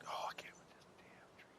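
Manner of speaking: frustrated whisper; whispered gripe. Real, not acted. Player annoyed by the tree, whispered gripe. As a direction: grumble it without voice.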